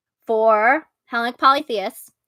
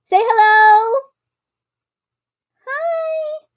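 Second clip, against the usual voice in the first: higher, louder sing-song coaxing, then a tiny squeaked hi; 13 semitones higher, 3 dB louder, much slower than usual. Real, not acted. Coaxing the cat to greet viewers, in high baby talk. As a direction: coo at the kitty.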